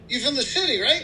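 high-pitched voice for the friendly country neighbor. Acted. Guy in pickup greeting new neighbor, high-pitched friendly voice.

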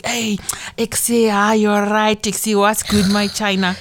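drawn-out opening in imitated Goffle accent; mock Goffle accent. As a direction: exaggerate a chummy Goffle greeting. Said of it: "if I see this Goffle chick".

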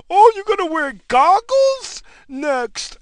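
high, slow mock voice; mocking Fauci. Mimicking Doctor Fauci predicting goggles, high slow mock voice. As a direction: parody the doctor's warning.

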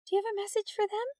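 high, quick, lilting question; musical voice. A narrator's reading. Teacher asking the intercom caller for a message, musical. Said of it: "she called in a musical voice".

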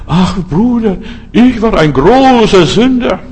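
high, drawn-out mock confession; reuige Entschuldigung. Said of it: "du musst dich auch von jemand entschuldigen". (übertrieben reuiges Geständnis, hoch und gedehnt)

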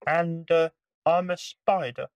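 high, small, timid creature; tiny spider voice. Acted. Spider introduces itself.

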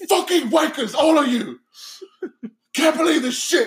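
high, shouted British disgust; pissed-off British gangster. Retold or imitated. Imitating a gangster walking out on gunless men, pissed-off British accent. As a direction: write them all off in outraged British. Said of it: "he's pissed off".

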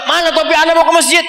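a hurried husband hunting his cap before the mosque; impatient husband. Acted. (suami tergesa menanyakan topi, suara tinggi dan cepat)

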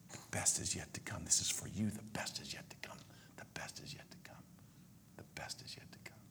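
soft unvoiced whisper, fading; repeated whisper. Preacher voicing God's refrain to himself, whispered.

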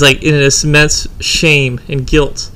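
ordinary delivery

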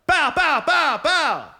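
high loud gunshot sounds; gun noises. Retold or imitated. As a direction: blurt out toy gunshots. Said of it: "and was like".

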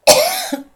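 sharp choking cough. Real, not acted. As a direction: cough out the swallowed drink. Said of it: "mi è dato di traverso".

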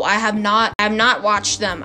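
ordinary talk

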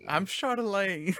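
high, slow Southern drawl; Southern character. Pretend. Host playing Chardelaine as a madam, high Southern character voice. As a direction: introduce yourself as a Southern madam. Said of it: "a Southern character".

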